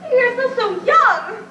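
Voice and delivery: normal talking voice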